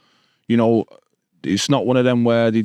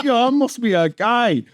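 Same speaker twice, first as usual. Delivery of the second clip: proud younger self; 11 semitones higher than usual. Retold or imitated. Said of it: "I was like".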